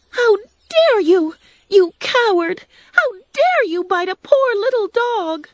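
high, loud, tearful scolding; angry scolding. Acted. Dorothy hitting the lion's nose, scolding him angrily. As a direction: tell the coward off with outraged tears.

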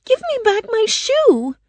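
put-on very high-pitched little-girl protest; upset girl's cry. A narrator's reading. Dorothy demanding her shoe from the witch, crying out. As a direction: cry out as a wronged young girl. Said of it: "Dorothy cried".

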